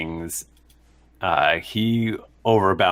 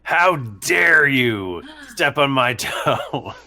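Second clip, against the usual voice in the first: very slow, raised-pitch mock outrage; indignant guard; 6 semitones higher, much slower than usual. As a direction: draw it out as a hammed-up affronted guard. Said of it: "He goes, uh".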